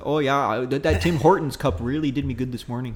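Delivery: chatty Canadian lilt; Canadian accent. Shown as a demonstration. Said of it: "it's more up here in the mouth".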